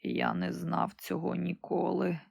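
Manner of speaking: a dying emperor's faint confession; weak dying voice. Acted. Dying emperor speaks weakly. (слабкий голос умираючого)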